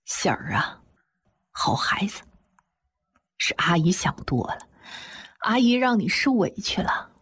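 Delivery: gently choked-up aunt, breath catching in the words. A narrator's reading. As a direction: narrate a tender apology through a slight lump in the throat. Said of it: "哽咽地对我说".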